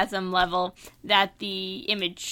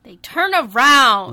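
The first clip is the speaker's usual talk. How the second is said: slow, loud and knowing; loud punchline; 4 semitones higher, 10 dB louder, slower than usual. Acted. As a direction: land the punchline big and slow. Answering punchline.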